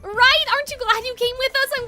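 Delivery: excited. Acted. Eager reply to a friend.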